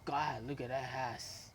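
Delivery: slow, low murmur of admiration; slow appreciative aside; admiring murmur. Retold or imitated. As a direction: savour every word, appreciating what you see. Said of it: "and I said".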